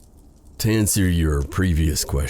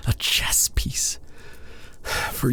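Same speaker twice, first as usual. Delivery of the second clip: whisper; 7 semitones higher, 5 dB quieter, slower than usual. Acted. Bitter self-reproach, voice drops.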